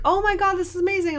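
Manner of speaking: a hint of an impressed stranger; mock gushing lady. Gushing lady retold at show.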